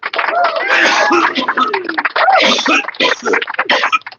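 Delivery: laughing through coughs; coughing laugh. Real, not acted. Coughing and laughing after a hit.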